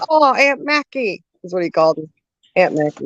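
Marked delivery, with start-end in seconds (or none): 0.1-1.2 put-on higher, slow small-child voice; little nephew voice. Nephew in car seat calling to his aunt, small child voice. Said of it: "he's like".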